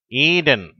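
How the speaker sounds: ஈடன் with a stretched "EE"; long first vowel. Shown as a demonstration. Teacher gives an example word, long-vowel demo. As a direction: hold the opening of ஈடன், "EEE-den". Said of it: "நெடில் ஓசையோட".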